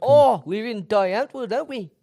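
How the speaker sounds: mock British accent. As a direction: ham up the posh British accent. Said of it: "Now you're British".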